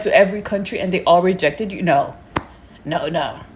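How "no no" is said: usual speaking voice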